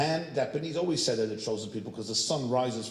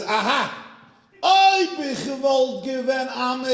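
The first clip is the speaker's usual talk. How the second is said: high, loud, slow mock proclamation in Yiddish; hammed-up triumphant Yiddish outburst; triumphant exclamation; 10 semitones higher, 9 dB louder than usual. The rabbi answers the woman in triumphant Yiddish. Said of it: "He went like this".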